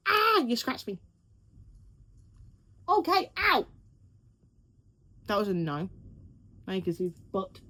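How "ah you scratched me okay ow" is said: startled pain. Real, not acted. Cat scratches her.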